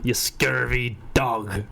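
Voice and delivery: slow, low pirate insult. Pretend. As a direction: snarl it like a pirate captain. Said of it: "I think that's the point".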